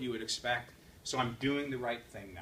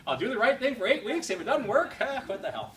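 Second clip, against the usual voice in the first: laughing mock excuse; 7 semitones higher, 7 dB louder than usual. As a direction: laugh through it, higher and louder. Mocking his own excuse.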